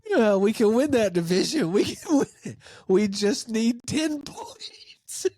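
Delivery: high squeal dissolving into breathy wheeze; giddy laughing. Real, not acted. Host joking that the Razorbacks could win that division, giddy wheezing laughter.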